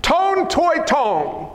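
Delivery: "Ton toi ton" pronounced deliberately, high; emphatic Greek quote. Shown as a demonstration. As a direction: draw out each word of "Ton toi ton".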